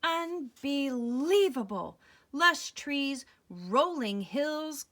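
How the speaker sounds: loud, slow, marveling read-aloud; excited story reading. Acted. Reading a picture book to a child, loud and awed. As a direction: paint the paradise with wonder.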